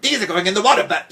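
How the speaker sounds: fast, high, furious outburst; furious threat. A narrator's reading. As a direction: shriek the threat in a rush. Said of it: "Then she screamed".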